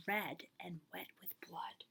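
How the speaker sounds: whispered chilling discovery; eerie whisper. A narrator's reading. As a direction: breathe out the creepy detail. Cats' mouths red with blood.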